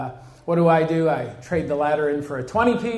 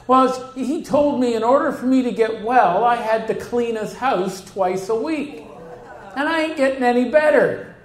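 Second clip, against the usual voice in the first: indignant woman; 6 semitones higher than usual. Retold or imitated. Voicing the complaining woman.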